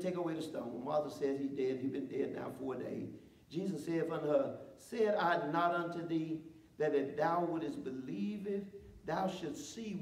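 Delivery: measured, slowed Bible reading; scripture reading. Acted. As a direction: read the passage with weight. Reads scripture aloud.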